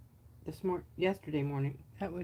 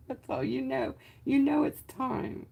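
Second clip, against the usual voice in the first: coaxing high voice; 8 semitones higher, 6 dB louder than usual. Pretend. Coaxing voice to the pigment.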